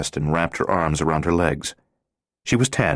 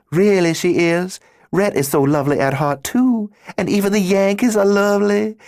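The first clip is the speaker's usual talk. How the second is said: put-on thick Southern accent; 5 dB louder than usual. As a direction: ham up a Southern accent, all fake sweetness. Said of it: "She put on a thick Southern accent and spoke with exaggerated sympathy".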